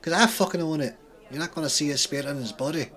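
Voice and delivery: loud, fast Scottish gloat; smug Scottish accent; thick Scottish accent. Acted. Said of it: "He has a thick Scottish accent".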